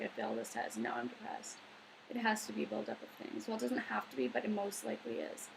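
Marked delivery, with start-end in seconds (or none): 0.0-1.6 much lower, quieter mocking voice; mock dopey quote. Mocking someone who blames one failed test, low mock voice. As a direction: drop low to parody the whiner. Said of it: "It's not just, like".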